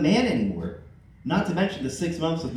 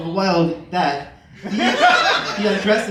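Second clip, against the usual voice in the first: laughing; 3 semitones higher, 7 dB louder, much slower than usual. Real, not acted. Reader laughing through the line.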